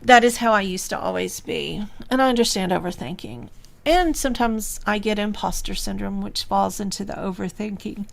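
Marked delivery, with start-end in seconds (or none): none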